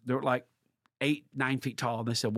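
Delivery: normal talking voice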